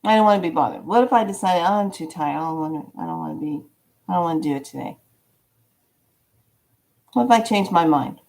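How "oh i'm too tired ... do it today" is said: softer, fast, mumbly pretend tiredness; half-hearted whiny excuse, trailing down; mock tired excuse. Imagined lazy excuse.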